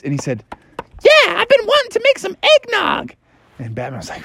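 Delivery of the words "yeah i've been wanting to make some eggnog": squeaky Robin voice. Acted.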